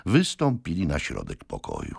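ordinary delivery, nothing marked

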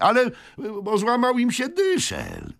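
high, halting stableman voice; stammering stableman. A narrator's reading. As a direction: stumble over the explanation, high-pitched. Stableman goes on.

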